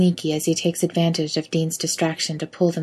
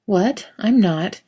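mock innocent; 3 semitones higher, 3 dB louder, slower than usual. A narrator's reading. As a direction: feign innocence. Denying being obvious.

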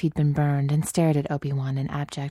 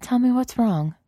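higher, gentle woman's voice; Padme's voice; 9 semitones higher than usual. Acted. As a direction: Padme asking tenderly.

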